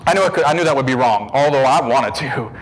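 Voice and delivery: usual speaking voice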